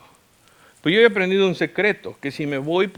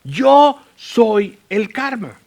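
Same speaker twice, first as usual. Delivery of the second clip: high, loud mock proclamation, each word stretched; hammed-up, drawn-out bellow; imitated angry shout; 7 semitones higher, 8 dB louder, much slower than usual. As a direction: hammer it out very slowly, like an irate driver. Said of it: "así me decía".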